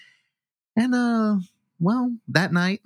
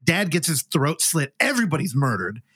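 ordinary talk, nothing marked

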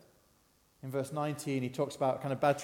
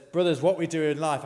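lightly acted battle speech, louder; a hint of a general rousing soldiers; Maximus rallying troops; 2 semitones higher, 8 dB louder than usual. Preacher quoting a film hero to the congregation, mock-rousing. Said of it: "these lines to inspire his troops".

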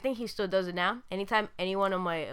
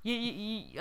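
normal talking voice

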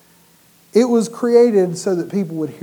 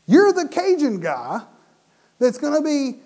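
usual speaking voice

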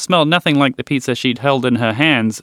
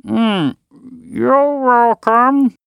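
higher, slow words round a mouthful; mouth full; 10 semitones higher, much slower than usual. A narrator's reading. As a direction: talk through a full mouth. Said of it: "said through a full mouth".

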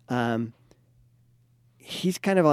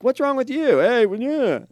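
louder, much higher imitated irritated husband; mock defensive snap; 12 semitones higher, 8 dB louder than usual. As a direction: play the husband taking it personally. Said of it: "He would start to personalize her upset".

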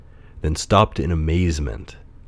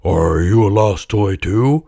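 slow, lumbering bear voice; teddy bear; 4 dB louder than usual. A narrator's reading. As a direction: ask slowly as a toy bear. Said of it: "asked a teddy bear".

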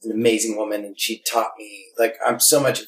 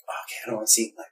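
hurried inner thought; 3 semitones lower, 8 dB quieter, much faster than usual. Retold or imitated.

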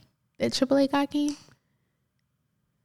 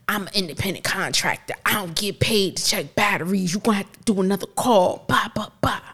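imitated brusque refusal, ending in rattled-off blahs; rude tire guy. Roadside guy refusing to check her battery, imitated rudeness. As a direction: mimic a put-upon contractor refusing. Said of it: "He was really rude".

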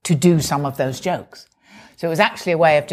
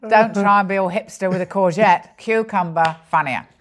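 ordinary delivery

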